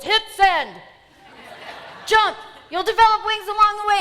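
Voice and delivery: loud, high urgent yell; urgent shout. Retold or imitated. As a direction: shout him on to jump. Bob urging her over the phone.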